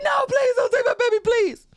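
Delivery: high, loud, desperate plea; mother begging child services not to take her daughter. Retold or imitated. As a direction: beg them not to take the baby.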